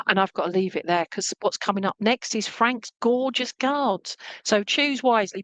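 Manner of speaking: ordinary talk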